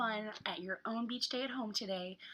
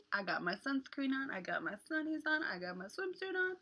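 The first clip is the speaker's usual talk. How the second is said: ordinary delivery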